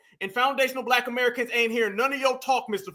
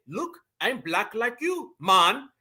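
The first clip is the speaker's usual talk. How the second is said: mock Jamaican accent; 2 semitones lower, much slower than usual. Mimicking people who show up claiming kinship, mock Jamaican accent. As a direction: lightly imitate a Caribbean immigrant's Jamaican accent.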